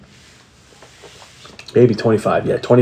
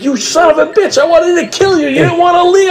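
much higher, teasing mock scolding; exasperated wife. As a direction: play the wife venting in relief. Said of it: "she's like".

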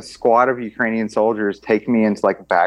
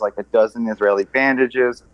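normal talking voice

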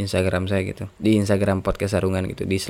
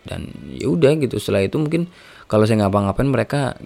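ordinary talk

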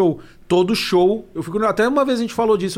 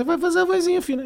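put-on thin, much higher little-kid voice; child's voice; 12 semitones higher than usual. Shown as a demonstration. Impressionist showing how to fake a child, high thin voice. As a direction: pitch it right up into a tiny child voice. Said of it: "faz uma voz de criança".

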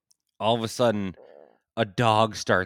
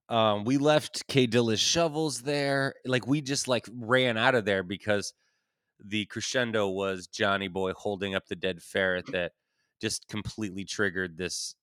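ordinary talk

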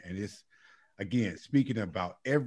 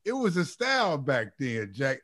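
higher-pitched playful seventies jive; seventies hip cat; 8 semitones higher than usual. Retold or imitated. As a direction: lightly imitate a cool cat stuck in the seventies. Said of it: "his terminology was still stuck in nineteen seventy".

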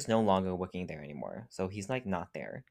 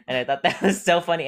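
laughing; 7 semitones higher, 9 dB louder, faster than usual. Real, not acted. Laughing at the story ending.

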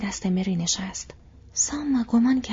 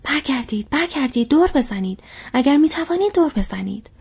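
high, loud orders, building in force; urgent shouted command; 6 semitones higher, 6 dB louder than usual. A narrator's reading. Aragorn orders the boats to turn back, shouting. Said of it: "آراگورن فریاد زد".

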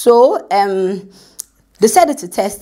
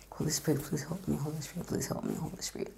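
whispered, much softer prayer; under my breath; 5 semitones lower, 21 dB quieter, faster than usual. Shown as a demonstration. In front of the interviewer, praying under her breath in a whisper. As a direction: breathe the plea almost silently. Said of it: "I'll begin to say under my breath".

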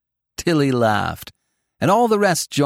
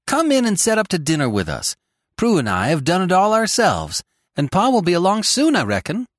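higher, eager invitation; eager cry; 6 semitones higher than usual. A narrator's reading. Tilly invites the visitor to dinner, eager cry. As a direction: call it out eagerly, pitched up. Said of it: "cried Tilly".

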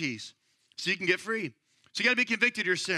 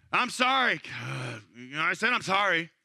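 grudging apology; 4 semitones lower, slower than usual. Retold or imitated. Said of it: "I can really feel that from you".

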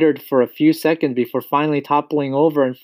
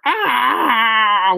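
long, high, falling scream; loud bellow; 14 semitones higher, 5 dB louder than usual. A narrator's reading. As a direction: bellow out one drawn-out yell. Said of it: "she bellowed even louder".

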